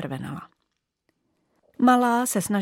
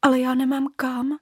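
small, higher and timid; a bit scared; 5 semitones higher than usual. Acted. Little bauble answering the bully, scared. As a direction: plead helplessly. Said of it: "trošku ustrašeně".

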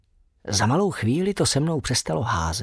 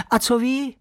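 excited; 11 semitones higher than usual. A narrator's reading. Bat overjoyed to see professor.

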